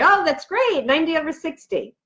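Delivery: high, breezy doctor brushing off the numbers; patient mimicking her doctor, mock upbeat; mock cheerful doctor. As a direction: play the doctor who finds it great. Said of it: "they say".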